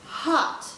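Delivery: Hot breathed out, no voice; emphatic hot. Shown as a demonstration. Teacher showing really Hot. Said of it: "You slow it down".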